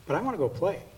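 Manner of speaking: put-on a touch of a whining child. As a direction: whine like a kid.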